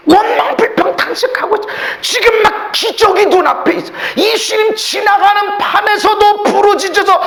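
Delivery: full-force, high-pitched preaching. Acted. Preacher retelling neighbors' blame of the blind man, impassioned preaching. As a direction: drive the message home loudly, hardly letting up.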